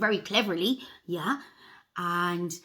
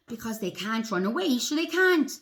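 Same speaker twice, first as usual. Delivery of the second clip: ordinary delivery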